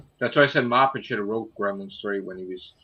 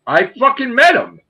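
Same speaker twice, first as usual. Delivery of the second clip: loud, high-pitched insistence; emphatic outburst; 11 semitones higher, 10 dB louder than usual. Real, not acted. Insists after being teased about fandom.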